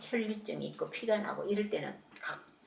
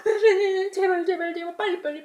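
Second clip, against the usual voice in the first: high, loud, crying plea; tearful begging; 11 semitones higher, 6 dB louder than usual. Acted. Kneeling father crying out to Jesus to hurry, tearful and high. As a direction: beg through tears, please please.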